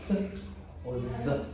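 "Th" alone, then softly voiced "the"; isolated th sounds. Shown as a demonstration. Teacher asks what sound it makes, isolated th sounds. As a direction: contrast breathy "Th" with voiced "the". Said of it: "What sound does it make?".

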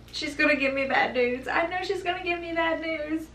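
bracing for the worst, voice lifting; dreading bad news. Mother remembering her fear during labor, voicing tense dread. Anxious inner thought, high.

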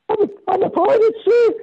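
high, bashful little confession; sheepish pirate answer. Retold or imitated. Telling Prince Andrew what he is, a high bashful answer. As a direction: admit it with a shy giggle. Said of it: "And I went".

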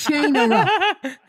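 slow, high, half-laughing threat; a high, laughing, drawn-out threat; lost boys' threat. Acted. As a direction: drag it out, giggling, as the boys. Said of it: "they cried".

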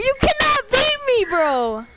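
yelled boast; loud trash talk. Real, not acted. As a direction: shout the brag. Hyped gaming trash talk.

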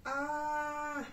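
ordinary delivery

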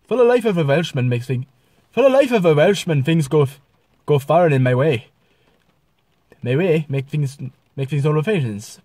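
slightly off Welsh lilt, jumping in pitch; Welsh accent. Shown as a demonstration. Vlogger playing a welcoming Welshman, Welsh accent. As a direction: have a go at sounding Welsh. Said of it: "kinda close enough of a Welshman".

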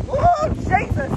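high, drawn-out startled cry; real jolt of surprise, stretched slowly; real startled ooh, very high. Real, not acted. Startled by weather outside.

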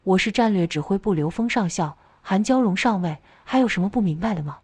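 ordinary delivery

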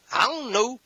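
high, whiny I don't know; mock sulky spouse. Preacher imitating an unpleasant spouse, high and whiny. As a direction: shrug it off like a pouting mate.

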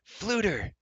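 high, delighted hail to a friend; high falling call of greeting. A narrator's reading. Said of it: "he called".

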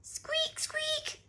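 very high, tiny squeaks; little rabbit squeak. A narrator's reading. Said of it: "said Richard".

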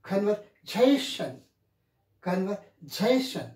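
slow "Conversation", strong on "-sa-"; teacher's practice model, "Conversation" drawn out on "-sa-"; primary and secondary stress. Shown as a demonstration. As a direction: drill "Conversation" as con-ver-SA-tion. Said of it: "We have to practice".

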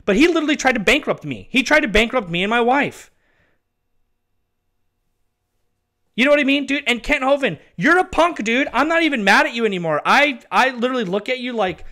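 loud, high, heated outburst; angry rant. Real, not acted. Vlogger venting at Kent Hovind, loud angry rant. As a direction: shout your anger at him, voice climbing.

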